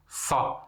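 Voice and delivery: Sa breathed with the Arabic emphatic sad; emphatic sad sound. Shown as a demonstration. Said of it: "Essas duas letras S, letra sad". (som da letra sad isolado)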